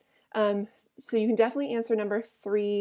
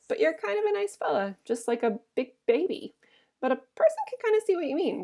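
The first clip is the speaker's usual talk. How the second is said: higher, lightly voiced woman; high woman's voice; 7 semitones higher than usual. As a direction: lift into her teasing voice. Curly's wife voiced higher.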